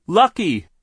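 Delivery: high, very loud single-word shout. A narrator's reading. Eldest sister commands her hypnotized brother, shouted. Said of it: "the eldest Baudelaire shouted".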